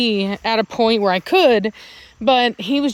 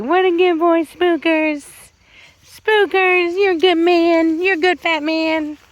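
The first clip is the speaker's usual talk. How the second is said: higher and louder doting coo; 8 semitones higher, 4 dB louder than usual. Real, not acted. Owner gushing praise at Spooky, higher louder baby talk.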